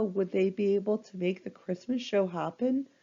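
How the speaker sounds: plain reading voice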